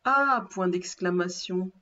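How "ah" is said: higher, louder cry of exclamation. A narrator's reading. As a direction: exclaim it as the woodcutter's wife. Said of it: "s'écria la bûcheronne". (s'exclamer, voix haute et forte)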